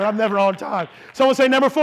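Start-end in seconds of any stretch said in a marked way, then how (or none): 1.1-1.8 loud, high call for a response; rousing call-out. Real, not acted. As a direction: fire up the congregation. Calls out for congregation's response.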